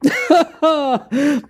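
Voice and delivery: high mocking villain laugh; haughty laugh. Rival owner laughs at Majima with a high haughty laugh. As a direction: laugh down at him from above.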